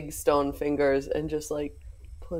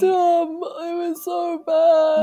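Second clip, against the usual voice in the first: very high, slow wail; hammed-up crying; 12 semitones higher, 4 dB louder, slower than usual. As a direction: bawl it out in character. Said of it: "big old tears just start going".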